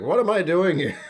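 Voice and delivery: high, loud mock alarm; loud, pretend-bewildered outburst; mock bewilderment. Imagined reaction to being on show.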